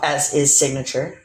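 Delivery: normal talking voice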